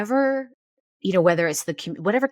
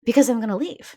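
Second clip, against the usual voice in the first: higher, on the edge of crying; close to tears; 7 semitones higher, 5 dB louder, faster than usual. Real, not acted. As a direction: voice rising as tears come. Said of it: "Now I'm gonna cry".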